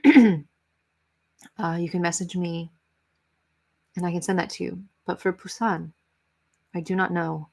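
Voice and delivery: usual speaking voice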